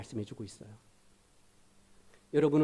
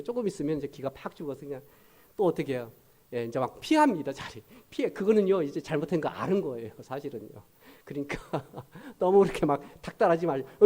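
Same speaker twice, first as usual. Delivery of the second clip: normal talking voice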